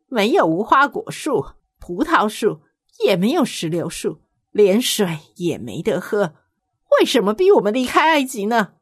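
whiny grumbling crowd voice; complaining. Acted. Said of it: "他们抱怨着说".

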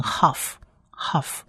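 "huff" opening on 呵, not K; H instead of K. Shown as a demonstration. Teacher explains the missing dot, "huff" with an H. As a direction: say "huff" slowly with a breath-made H. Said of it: "因为它中间没有黑色的一点".

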